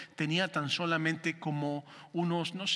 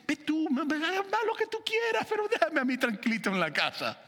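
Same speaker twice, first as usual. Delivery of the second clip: higher, whiny plea to be left alone; pleading husband; 8 semitones higher than usual. Retold or imitated. Preacher replays begging his wife to let him stay home, whiny. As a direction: beg off going to the store. Y le digo.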